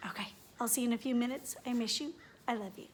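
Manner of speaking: ordinary talk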